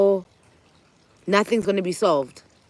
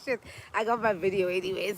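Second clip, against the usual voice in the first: muttered aside; 11 dB quieter, faster than usual. Retold or imitated. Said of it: "Then I was like".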